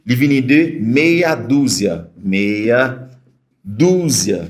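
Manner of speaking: meia dúzia spoken apart, Portuguese vowels. Shown as a demonstration. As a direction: pause between meya and dúzia. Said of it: "se la m kwè aksan".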